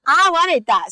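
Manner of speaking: excited about meeting the Pope. Real, not acted. Excited about singer's fame.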